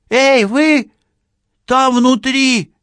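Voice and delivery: very high, loud drawn-out holler; shouting. A narrator's reading. Policewoman hailing the silent killer inside, shouting. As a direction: holler slowly to someone behind a door. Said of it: "крикнула она".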